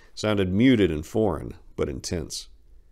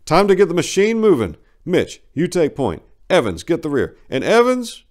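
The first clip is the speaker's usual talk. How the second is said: loud, raised sergeant voice; commanding sergeant; 11 semitones higher, 10 dB louder than usual. Acted. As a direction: bark the orders to the squad. Voiced sergeant giving orders.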